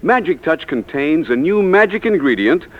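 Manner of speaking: usual speaking voice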